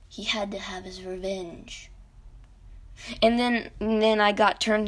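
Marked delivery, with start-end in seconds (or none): 0.1-1.9 hushed dramatic whisper; much softer, breathed ominous aside. Real, not acted. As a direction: whisper it like a dark secret.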